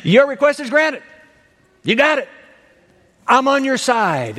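high, emphatic declaration; triumphant granting. Acted. Jesus grants the woman's request.

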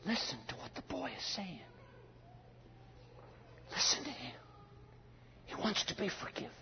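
very soft whisper; hushed, secretive summons; God confiding. Acted. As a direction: whisper it to draw a listener close. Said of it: "And he says".

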